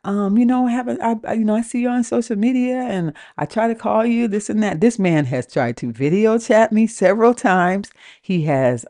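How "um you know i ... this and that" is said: higher-pitched mimicry of an aggrieved man; put-on high caller voice; imitated complaining caller. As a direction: replay his grievance in a raised voice. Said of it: "He goes".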